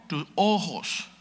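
ordinary talk, nothing marked

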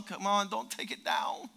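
ordinary talk, nothing marked

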